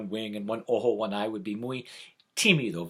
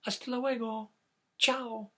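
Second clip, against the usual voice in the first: high chick goodbye demo; 9 semitones higher than usual. Shown as a demonstration. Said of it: "You wanna try that?".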